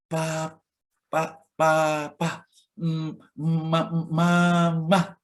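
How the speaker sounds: put-on halting baby syllables, stretched; baby's first words. Shown as a demonstration. Preacher imitating a baby learning to say papa, halting. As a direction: sound out papa and mama like an infant. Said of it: "anaknya bisa ngomong".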